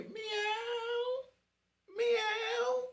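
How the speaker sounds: high, drawn-out cat wail; meowing cat. Retold or imitated. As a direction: meow long and high like a crying tomcat. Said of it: "meow and cry like a baby".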